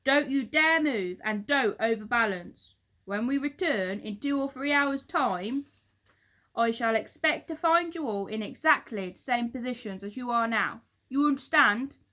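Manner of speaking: loud barked command; fearsome monkey trainer. A narrator's reading. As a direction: bark it at the caged monkeys.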